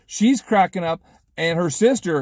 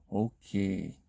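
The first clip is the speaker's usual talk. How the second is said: low, soft, drawn-out reply; deflated acceptance; 6 semitones lower, 11 dB quieter than usual. Retold or imitated. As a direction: let it drop quietly. His retold deflated final reply.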